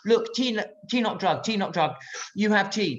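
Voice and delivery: high, insistent pleading in broken English; imitating Iraqi guards. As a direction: mimic guards coaxing a stubborn prisoner. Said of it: "Then you hear the Iraqis go".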